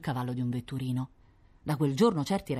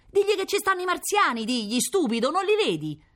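exasperated woman; 3 dB louder than usual. Acted. Woman scolding Augusto.

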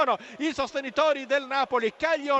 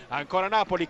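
usual speaking voice

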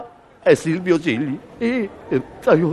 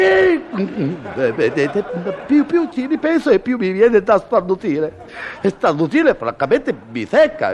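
usual speaking voice

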